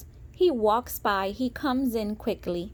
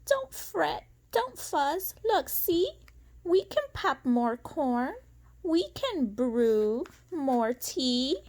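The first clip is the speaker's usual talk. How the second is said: much higher, tiny mouse voice; squeaky mouse; 6 semitones higher, slower than usual. Acted. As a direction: comfort the bear as a squeaky little mouse. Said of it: "Mouse squeaks".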